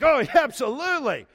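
high, loud, put-on eager agreement; hammed-up mock excitement; fake enthusiasm. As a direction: play the confident leader while faking it. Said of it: "I was faking being the spiritual leader".

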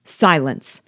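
plain reading voice

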